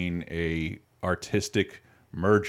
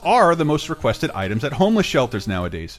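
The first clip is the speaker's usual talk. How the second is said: upbeat sponsor read, slightly higher; 6 semitones higher than usual. Acted. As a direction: sell the socks like a radio host. Reads a sponsor ad.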